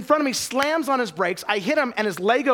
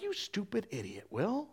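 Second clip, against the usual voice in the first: muttered self-scolding; 14 dB quieter than usual. Retold or imitated. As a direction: mutter it under your breath, annoyed at yourself. Scolding himself while driving.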